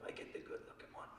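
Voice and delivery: hushed unvoiced aside to the wife; whisper. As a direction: whisper it so only she hears. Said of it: "whispered to my wife".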